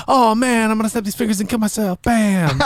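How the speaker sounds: high-pitched, hurried comic impression; Michael Pena recap. Playing a hero lip-synced to a comic recap, Michael Pena recap. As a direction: race through it in a high goofy voice. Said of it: "run back of the M C U from Michael Pena with all of the characters, including R D J".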